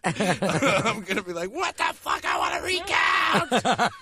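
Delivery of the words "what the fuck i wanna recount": drawn-out, high mock-outraged shout; mock meltdown, stretched and high-pitched; losing it. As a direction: shout it like a hammed-up sore loser. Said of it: "I'm gonna lose my shit".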